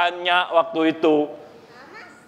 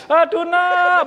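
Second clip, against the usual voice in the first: exclaiming; 10 semitones higher, 4 dB louder, slower than usual. Real, not acted. Preacher's own exclamation.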